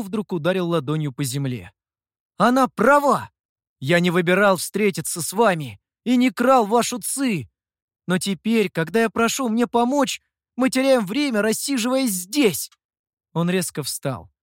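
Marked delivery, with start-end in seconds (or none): 2.4-7.5 loud, high-pitched protest; raised-voice, agitated defence; heated outburst. A narrator's reading. Youth slaps ground, protests angrily. 8.1-12.7 high, agitated complaint; urgent, indignant reproach; heated protest. A narrator's reading. Same angry protest continues.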